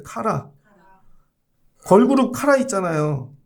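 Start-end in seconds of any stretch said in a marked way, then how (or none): 0.0-0.5 카라 said slowly and clearly; Hebrew word kara. Shown as a demonstration. Preacher teaching Hebrew word, clear demo. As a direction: model 카라 for the congregation to repeat. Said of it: "해보세요".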